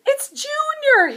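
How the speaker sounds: high, loud happy shout; excited shout. Acted. Mister Bird greeting his new baby, excited shout. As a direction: cry out the name with joy. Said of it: "he shouted".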